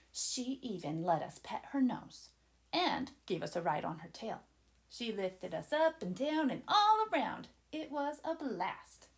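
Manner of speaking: plain reading voice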